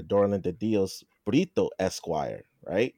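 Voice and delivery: normal talking voice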